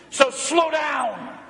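slow, drawn-out shouted "slow down"; blunt order. Retold or imitated. Wife's blunt warning to her speeding husband, shouted. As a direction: hammer each word home. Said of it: "She was blunt".

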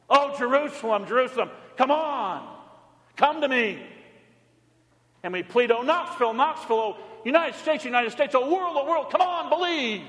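high, loud pleading sermon; impassioned plea; fervent pleading. Acted. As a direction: beg the world to come. Pleading appeal.

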